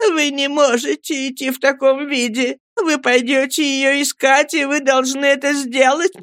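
high, distressed woman's voice; high emotional entreaty; tearful pleading. A narrator's reading. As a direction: implore him tearfully, pitched well up. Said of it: "закричала вся в слезах миссис Гумидж".

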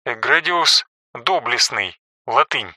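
soft footnote reading; quiet aside. A narrator's reading. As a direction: drop the voice for a footnote.

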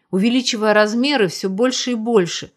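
ordinary delivery

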